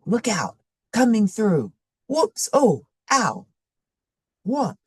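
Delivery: mock startled yelps. Narrator voicing stumbling in dark.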